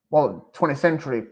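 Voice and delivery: ordinary talk, nothing marked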